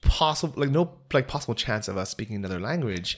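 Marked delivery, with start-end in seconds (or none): 0.0-0.5 higher and louder, drawn out slowly; emphatic exclaim. Real, not acted. Complaining that English speakers never learn languages, emphatic. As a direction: insist there's no chance at all.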